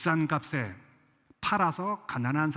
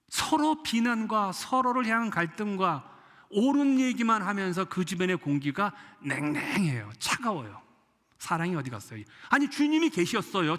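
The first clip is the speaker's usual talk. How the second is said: ordinary talk, nothing marked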